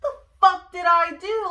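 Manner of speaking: loud, high-pitched angry protest; indignant retort. Retold or imitated. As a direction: fire back in outraged disbelief. Indignant retort to screaming officer.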